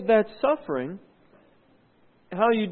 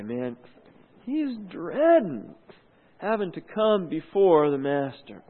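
normal talking voice

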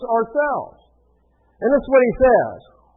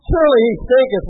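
louder, higher mock-solemn quote; amused declaiming; 4 semitones higher, 7 dB louder than usual. Preacher relishes the King James wording, amused declaiming. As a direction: proclaim the old wording for comic effect. Repeats the funny line for effect.

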